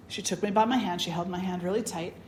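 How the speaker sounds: ordinary talk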